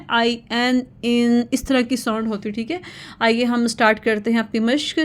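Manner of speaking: ordinary talk, nothing marked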